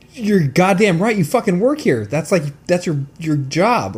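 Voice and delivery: worked-up comic indignation; retold indignant rant. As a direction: tell off the useless clerk, voice climbing. Retold outburst at clerk.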